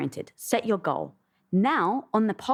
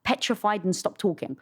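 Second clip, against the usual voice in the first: ordinary delivery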